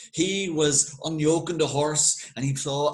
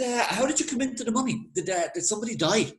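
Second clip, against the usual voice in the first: lightly voiced prying local, pitched higher; nosy local; 8 semitones higher, faster than usual. Local boyo pumping Doyle for gossip, a higher nosy voice. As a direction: ask it sly and higher, fishing for gossip.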